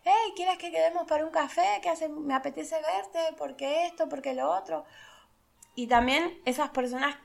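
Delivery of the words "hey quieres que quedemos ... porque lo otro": saludo agudo y entusiasta de una amiga; mensaje alegre imitado. Retold or imitated. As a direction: imita con voz alta y alegre un mensaje cariñoso. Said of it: "cuando tú recibes un mensaje". (amiga saludando por mensaje, voz aguda y alegre)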